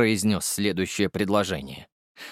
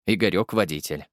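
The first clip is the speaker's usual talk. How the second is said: ordinary talk, nothing marked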